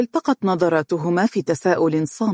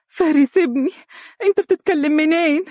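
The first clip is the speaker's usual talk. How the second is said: much higher, louder eager cry; a mother grabbing the phone, voice leaping; eager longing; 12 semitones higher, 3 dB louder than usual. Acted. Umm Faris calls her freed son, high eager longing. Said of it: "في شوق ولهفة".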